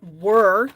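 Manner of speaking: "were" drawn out and emphasized; stress on WERE. Real, not acted. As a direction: lean hard and slow on "were". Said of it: "I stress the word were".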